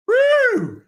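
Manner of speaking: high long woo; high slow whoop; excited whoop. Real, not acted. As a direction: let out a giddy cheer.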